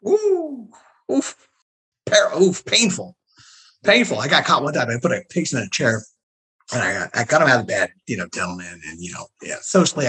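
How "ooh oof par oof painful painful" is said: high wincing oof; painful memory groan; pained groan. Real, not acted. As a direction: wince at the memory. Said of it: "reacts to the question".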